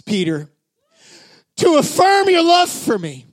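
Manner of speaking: high, loud, building preacher's cry; preaching at full force. Acted. Pastor urging the congregation about Peter, full-force preaching. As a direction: drive it home to the congregation, shouting.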